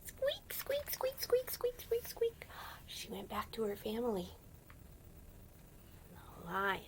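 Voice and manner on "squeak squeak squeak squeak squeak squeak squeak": very high quick squeaks; mouse squeaks. Pretend. Mouse runs home.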